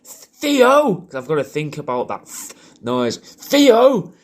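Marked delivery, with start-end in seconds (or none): 0.0-1.0 high, loud call across a park, "Theo" said as "Feo"; calling a dog in a park. Retold or imitated. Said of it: "I wouldn't be in a park going". 3.2-4.1 high, loud call across a park, "Theo" said as "Feo"; calling a dog in a park. Retold or imitated. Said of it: "Like that, I look like a fucking gimp".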